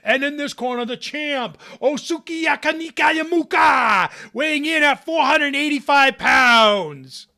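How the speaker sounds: loud, high booming fight call; ring announcer. Retold or imitated. Announcer introduces the sumo champ, booming shout. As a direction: shout the champ's name to the crowd. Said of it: "you hear him saying".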